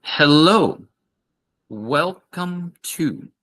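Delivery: high, very slow welcome, each word clear; slow repeat. Shown as a demonstration. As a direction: drill it slowly for the class.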